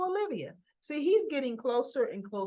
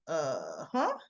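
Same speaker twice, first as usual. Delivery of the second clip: mock bafflement; 7 semitones lower than usual. [name] seeing Cyrus walk in, mock baffled. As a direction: play it dumbfounded, pitch leaping up at the end.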